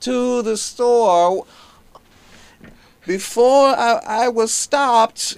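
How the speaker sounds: very high, loud and very slow; Cosby impression. Comic imitating Bill Cosby's slow story telling, high and slow. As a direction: stretch every word, way up high. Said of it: "And you go".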